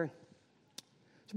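normal talking voice

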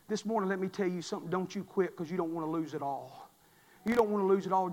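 very low, soft preaching; hushed appeal. Real, not acted. Preacher telling congregation not to quit, hushed appeal. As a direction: quietly urge them, almost under your voice.

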